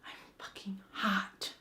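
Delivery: slow, soft unvoiced whisper; whispered aside. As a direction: breathe it out as a cheeky secret. Aside to camera.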